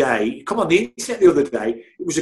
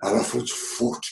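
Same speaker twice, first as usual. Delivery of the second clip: put-on whispered, light northeast English accent; 3 semitones lower, 6 dB quieter than usual. Kel's coffee order, whispered northeast accent impression. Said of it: "in that accent how he speaks".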